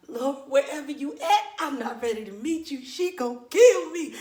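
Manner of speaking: high, quavering plea to heaven; frantic mock prayer. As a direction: pray in hammed-up terror. Acted frantic prayer before punishment.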